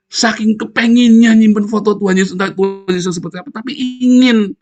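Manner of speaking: loud, high-pitched preaching outburst; high, forceful cry of exaggerated longing; emphatic exclamation. Real, not acted. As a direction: exclaim it at high volume, stretching the final word. Preacher exclaims over people's craving.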